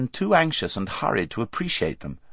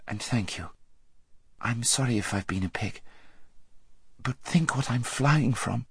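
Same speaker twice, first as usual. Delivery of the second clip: soft, sincere farewell; gentle apology; 8 dB quieter than usual. A narrator's reading. Aravis thanking her friend at parting, gently. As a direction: say it quietly, meaning it.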